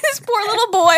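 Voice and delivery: ordinary delivery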